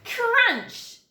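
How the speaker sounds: "Crunch!" thrown up high; a sudden loud snap of a word; dramatic sound word. A narrator's reading. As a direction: burst out with the crash.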